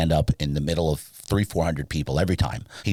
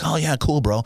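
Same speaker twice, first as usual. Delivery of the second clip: high, forced "cool" that hides envy; fake enthusiasm; 10 semitones higher than usual. To a buddy earning more, jealous mock cheer. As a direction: pretend to be happy for your friend. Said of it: "I wasn't even happy for him".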